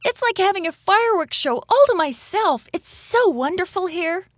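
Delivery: put-on high-pitched, wonder-filled child's voice; excited little girl. Little girl watching shooting stars from an orca's back, high excited wonder. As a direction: gush in a high, excited young voice.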